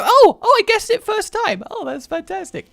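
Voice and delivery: squeaky, thrilled exclamation; delighted surprise. Real, not acted. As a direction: celebrate a lucky guess. Delighted at lucky guess in game.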